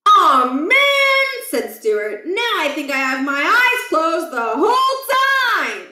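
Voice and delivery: put-on a boy upset about his closed eyes; dismayed kid. A narrator's reading. Stuart's dismayed cry.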